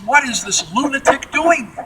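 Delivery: high, incredulous executive outburst; dumbstruck outrage. Retold or imitated. Boeing executives watching their test pilot roll the airliner, dumbstruck. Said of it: "The Boeing executives are dumbstruck".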